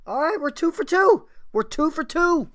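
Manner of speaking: loud, high-pitched celebration; elated. Real, not acted. Music reviewer cheering a good song, elated. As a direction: call it out high and loud, thrilled.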